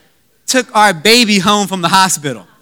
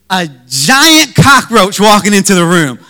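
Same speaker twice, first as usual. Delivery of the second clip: loud, dramatic near-shout; mock alarm; 6 dB louder than usual. Dad spots a roach while changing the diaper, mock alarm. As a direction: hype it up like a horror sighting.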